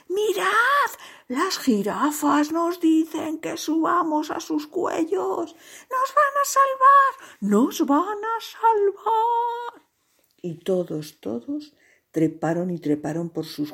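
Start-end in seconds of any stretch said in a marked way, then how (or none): 0.1-9.9 high, loud cries of relief, building; excited shouting. A narrator's reading. Animals shouting to each other that giraffes will save them. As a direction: call out the rescue, stretching the last words. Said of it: "se gritaban unos a otros".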